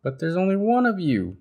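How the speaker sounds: little bird shouting at Sheep; shouted. Acted. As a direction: yell the objection childishly. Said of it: "shouted Bird".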